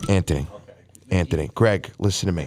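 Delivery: low, firm call for attention; insistent appeal. Real, not acted. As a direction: drop the pitch and demand to be heard. Trying to get the talkers' attention.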